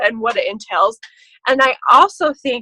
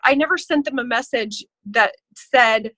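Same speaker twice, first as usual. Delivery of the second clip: ordinary talk, nothing marked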